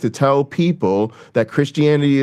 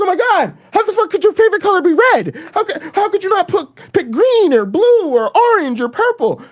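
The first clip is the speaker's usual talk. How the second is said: high incredulous mock voice, fast and laughing; squealing disbelief at a weird answer; shocked high mock voice; 3 dB louder than usual. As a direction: play the outraged crowd, way up high. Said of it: "Everyone's like".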